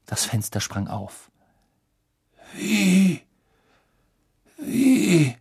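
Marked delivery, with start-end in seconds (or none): 2.6-5.4 breathless, stunned questioning; gasping in disbelief; exhausted bewilderment. A narrator's reading.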